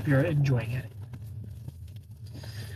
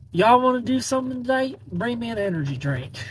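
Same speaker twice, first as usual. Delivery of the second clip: put-on playful Southern accent, pitched up at the start; 8 semitones higher than usual. Mom calling her kids over the walkie-talkie in a Southern accent. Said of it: "your southern accent".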